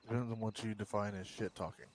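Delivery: soft, offhand reply, falling at the end; quiet aside. Real, not acted. Answering a friend about shit talking, quiet aside. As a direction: say it softly, easy to miss. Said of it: "What's that?".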